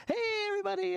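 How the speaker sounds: "Hey, everybody" pitched high; starting high. Retold or imitated. Husband mocking his wife's greeting: high start. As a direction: open way up high. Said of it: "Michelle, you're like".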